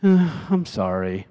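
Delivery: sighed, reluctant sorry; half-hearted, drawn-out apology; grudging apology. Acted. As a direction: groan first, then apologize slowly. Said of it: "they might have said".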